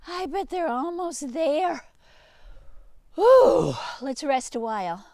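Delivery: a weary runaway sheep; tired sheep voice. Acted. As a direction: sigh out the whew like you're winded. Tired sheep stopping to rest.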